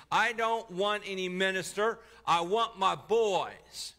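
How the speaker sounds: a father screaming for his dead sons; anguished shout. A narrator's reading. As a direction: raw grieving yell, drawn out at the end. Grieving father shoves the minister.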